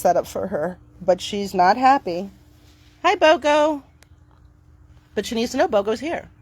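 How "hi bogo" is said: higher and cheery hello; upbeat greeting. Real, not acted. Greeting the dog Bogo, high and cheery. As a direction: sing out a friendly hi to the dog.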